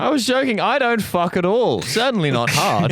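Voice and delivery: high, hammed-up pundit voice; mock Tucker Carlson. Mocking Carlson's quote in voice.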